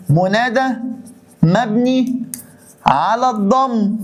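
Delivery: word by word, على الضم drawn out; dictating for notes; slow dictation. Shown as a demonstration. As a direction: spell out منادى مبني على الضم slowly. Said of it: "dictating the parsing".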